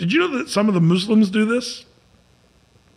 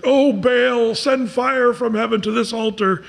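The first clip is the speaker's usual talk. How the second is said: raised, higher-pitched plea; acted invocation; 6 semitones higher than usual. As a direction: call up to a god, hammed-up. Prophets' plea to Baal acted out.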